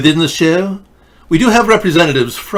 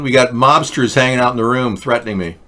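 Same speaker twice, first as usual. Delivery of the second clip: ordinary talk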